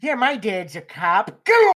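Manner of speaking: loud, high, slow joke quote; mock blurted confession. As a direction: play-act a loud, drawn-out boast. Mock confession blurted in player's place.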